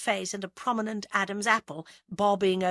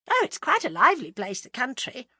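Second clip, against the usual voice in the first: breezy, higher-pitched cheer; cheerful; 6 semitones higher, 5 dB louder than usual. A narrator's reading. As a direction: brush it off cheerily. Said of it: "said Agatha cheerfully".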